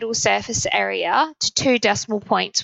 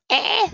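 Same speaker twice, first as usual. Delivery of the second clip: voice crack; 12 semitones higher than usual. Real, not acted. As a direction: voice cracking up high. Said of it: "my voice".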